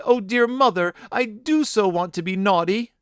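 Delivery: put-on high, pleading little-girl lament; sobbing child. A narrator's reading. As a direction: plead up high, mock-tearful. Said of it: "she sobbed".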